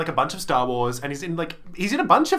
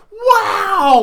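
high, loud awed wow; amazed child wow; 9 dB louder than usual. Retold or imitated. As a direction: gasp it like a wide-eyed kid. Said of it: "And I was like".

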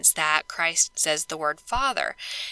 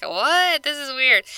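mock surprise; 7 semitones higher, 4 dB louder, slower than usual. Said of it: "we're kinda like".

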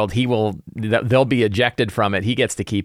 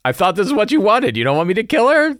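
much higher mock-innocent fiend; puzzled pit fiend; 11 semitones higher, 3 dB louder than usual. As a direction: play the fiend as baffled, not menacing. Pit fiend confused by players.

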